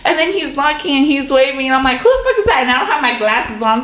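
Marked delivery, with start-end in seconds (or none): 2.0-2.6 high startled cry. Retold or imitated. Alone at night, startled by a stranger knocking. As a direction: yelp it out at a stranger at the door.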